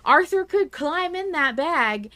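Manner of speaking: ordinary talk, nothing marked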